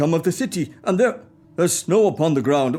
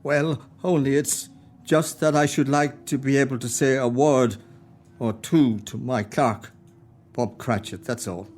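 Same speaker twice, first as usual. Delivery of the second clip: remorseful; 7 semitones lower than usual. Acted. Scrooge hiding remorse.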